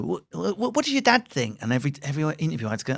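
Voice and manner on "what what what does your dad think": high, breathless reporter question; quoting a nosy journalist, pitched high; imitating an eager journo. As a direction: mimic a keen journalist pouncing.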